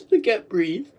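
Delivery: usual speaking voice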